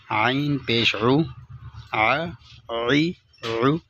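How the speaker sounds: عو held slowly, then ع عی عو chanted; slow vowel drill. Shown as a demonstration. Teacher drills the three vowel sounds slowly for students. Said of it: "یہ دیکھو".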